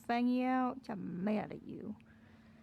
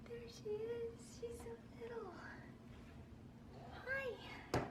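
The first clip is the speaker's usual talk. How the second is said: much higher and much softer cooing; cooing at a kitty; 8 semitones higher, 10 dB quieter, slower than usual. Real, not acted. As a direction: coo softly up at a tiny cat. Cooing at cat up high.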